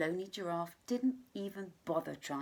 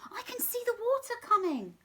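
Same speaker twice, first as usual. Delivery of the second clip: much higher, louder squawk; squawking cockatoo; 5 dB louder than usual. Acted. Cockatoo squawking at the animals to hurry. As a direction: screech it like a parrot. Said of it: "squawked the cockatoo".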